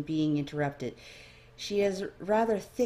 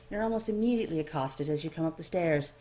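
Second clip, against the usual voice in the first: ordinary delivery, nothing marked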